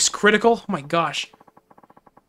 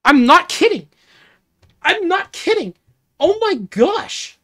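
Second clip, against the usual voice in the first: high-pitched incredulous exclamations, last one drawn out; real high-pitched astonishment; stunned disbelief; 10 semitones higher, 6 dB louder, slower than usual. Real, not acted. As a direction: cry out in real shock, pitch leaping up. Shocked by own search history.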